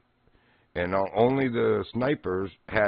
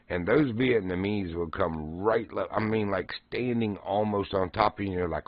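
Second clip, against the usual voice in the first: ordinary talk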